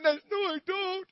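high, loud mimicked refusal; mock protesting. Preacher mimics woman brushing off compliments, high mock protest. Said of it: "You're like".

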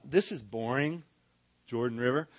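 low, flat-toned boredom; bored sigh of a river name. Shown as a demonstration. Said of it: "we like to say".

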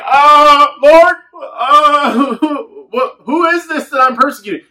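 high, loud, panicky stammer; terrified. Retold or imitated. Saul frightened, answering the voice, high and panicked.

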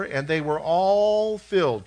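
"all" stretched long and loud; stress on "all". Real, not acted. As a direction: lean hard on "all" and draw it out. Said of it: "Can we say all?".

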